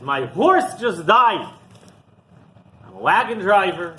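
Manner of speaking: loud, high lament; hammed-up wail, drawn out; mock loud sobbing. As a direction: cry out the bad news in acted despair. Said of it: "he's sobbing loudly".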